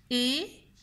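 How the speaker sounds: "éclair" split after é, then a long clair; every sound drawn out. Shown as a demonstration. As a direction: dictate "éclair" slowly so each sound is heard. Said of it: "Écoutez bien tous les sons". (« éclair » articulé lentement, son par son)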